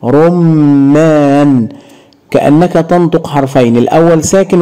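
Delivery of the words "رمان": رمان very slowly, meem doubled: rum-maan; drawn-out shadda demo. Shown as a demonstration. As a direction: hold the meem of رمان as two letters. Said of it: "لاحظ الميم". (رمان ممدودة ببطء شديد مع تشديد الميم)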